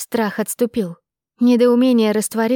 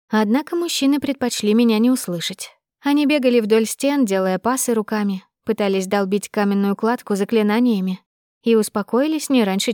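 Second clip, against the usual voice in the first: plain reading voice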